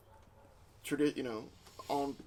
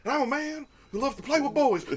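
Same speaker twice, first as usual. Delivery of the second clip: wrestling promo; 12 semitones higher, 6 dB louder than usual. Acted. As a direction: cut a cocky promo. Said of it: "wrestling promo".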